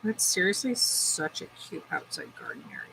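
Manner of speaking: soft unvoiced murmur to herself; hushed aside. Real, not acted. Browsing her phone, admiring a garden, soft murmur. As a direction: breathe it out under her breath.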